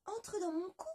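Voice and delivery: high, kindly little voice; half-chick's offer. Acted. La moitié de poulet invite le loup, voix aiguë. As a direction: invite him in generously.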